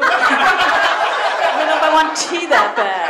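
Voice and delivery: very slow, doubtful drawl. Retold or imitated. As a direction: hesitate as if the tea is ancient. Guest refusing old tea.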